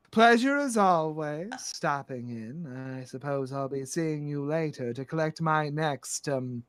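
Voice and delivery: slow, drawn-out grande dame; languid, gracious menace; composed, sinister madam. Acted. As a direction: sink low and soft in farewell. Plays Madam Storybrooke.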